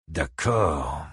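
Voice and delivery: low, guarded reply; circumspect. A narrator's reading. Buddy Lee responding warily to Ike, circumspect. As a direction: say it cautiously, holding back. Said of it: "d'un ton circonspect".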